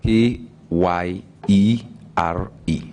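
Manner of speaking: spelled slowly, letter by letter; slow, separated letters K, Y, E, R, E; spelling out. Shown as a demonstration. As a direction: give each letter its own beat. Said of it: "spell the last name".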